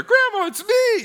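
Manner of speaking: even squeakier falsetto impostor; hammed-up pleading at the very top of the voice; fake grandson voice. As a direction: push the phony grandson up into extreme falsetto. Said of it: "whatever his voice sounded like".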